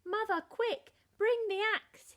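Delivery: loud, high urgent shout. A narrator's reading. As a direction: yell for his mother. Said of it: "he shouted".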